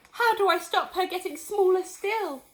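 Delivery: crying out. Acted. Missus Cranky crying out holding her tiny mother. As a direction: cry out for help.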